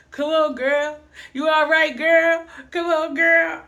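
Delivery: high, loud mocking taunt; teasing yell. Cousin loudly teasing the falling girl. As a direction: call out to her, laughing at her falls.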